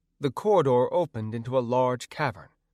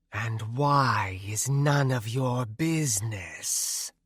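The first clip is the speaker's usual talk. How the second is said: eerie wraith voice; slower than usual. Acted. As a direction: ask like a drifting spirit. Wraith Nanaya speaks.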